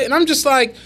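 ordinary talk, nothing marked

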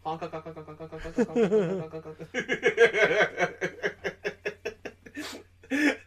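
high, silly repeated goose honks. Retold or imitated. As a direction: honk like a cartoon goose. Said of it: "and I just, like".

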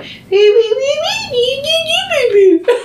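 high squeaky dummy voice, laughing; ventriloquist dummy voice. Retold or imitated. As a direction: play the puppet talking from the dummy. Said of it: "You turned him into a ventriloquist".

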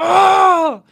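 grito agudo y fuerte de dolor imitado. Retold or imitated. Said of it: "Yo digo". (grito de dolor imitado, agudo y fuerte)

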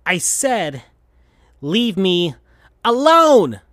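builds to a high loud yell on alone; shout. Acted. Sonic yelling at Tails to leave, building shout. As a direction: explode on the last word. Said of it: "shouted Sonic to Tails".

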